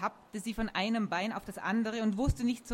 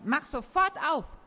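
higher, insistent order; commanding; 6 semitones higher, 4 dB louder than usual. A narrator's reading. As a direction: demand it, brooking no refusal. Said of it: "befahl sie".

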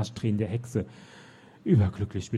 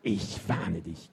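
slow, menacing witch warning, lower and softer; witch shrieking; 6 semitones lower, 4 dB quieter, much slower than usual. Acted. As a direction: draw the threat out like a hissing witch. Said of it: "kreischte die Hexe".

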